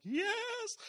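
high, excited little yes. Retold or imitated. Pastor sees an empty row, a high excited yes. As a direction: celebrate finding an empty row. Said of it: "And I'm like".

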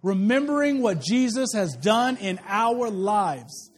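slow, measured, word by word; leading congregational recitation. Shown as a demonstration. Preacher leading everybody to say it, slow recitation. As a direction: draw out each word for everyone to follow. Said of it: "Everybody say that".

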